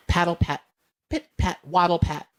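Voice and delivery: usual speaking voice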